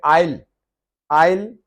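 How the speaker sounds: आइल said as "ail", no s sound; aisle with silent s. Shown as a demonstration. Teacher showing how to say silent-s aisle. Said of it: "एस silent है".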